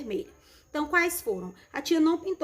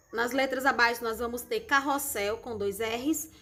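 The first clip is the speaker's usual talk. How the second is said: ordinary talk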